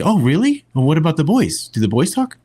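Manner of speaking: a hint of a mother coaxing her son; curious British mum. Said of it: "she's like".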